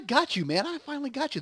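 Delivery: a hint of a villain savoring a win; gloating Potter. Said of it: "He's like".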